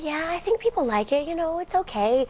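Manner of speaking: high, lilting, uncertain reassurance; unsure mother. Retold or imitated. Mother on the phone vaguely reassures her daughter. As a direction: hedge it lightly, voice lifting up. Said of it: "And she's like".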